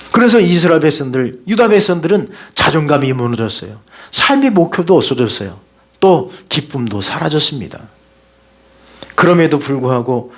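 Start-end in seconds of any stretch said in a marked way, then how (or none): none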